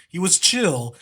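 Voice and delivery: ordinary delivery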